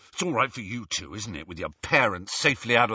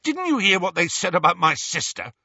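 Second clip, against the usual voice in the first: higher, louder, bitter tirade; angry rant; 8 semitones higher, 4 dB louder than usual. Acted. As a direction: rant at him, hurt and furious. Ron's angry rant at Harry.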